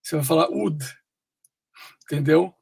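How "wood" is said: "wood" with a short oo; homophone of would. Shown as a demonstration. Teacher explaining the homophone, "wood" sounding just like would. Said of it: "é um homófono".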